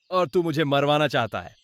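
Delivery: plain reading voice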